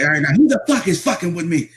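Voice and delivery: high, fast mock outburst; imitated flipping out. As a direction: lose it at a prank caller, mimicking him. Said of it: "Just flip it out".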